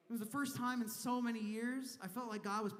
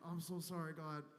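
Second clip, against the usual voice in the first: lower, softer, lazy stoner voice; stoner God; 4 semitones lower, 6 dB quieter than usual. Retold or imitated. Stoner God apologizes to God, low and soft. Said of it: "He's like".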